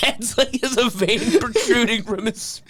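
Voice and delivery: ordinary talk, nothing marked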